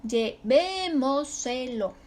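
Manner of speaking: slow Llevémoselo with a long VÉ; stretched stressed syllable. Shown as a demonstration. Teacher showing an accented word, slow Llevémoselo, long VÉ. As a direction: draw out VÉ, then measured syllables in Llevémoselo. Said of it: "es sobre esdrújula y se acentúa".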